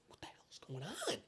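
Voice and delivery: whispered, stunned; dazed whisper. Retold or imitated. Recruit stunned by the yelling, whispering in confusion. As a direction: breathe it out in shock.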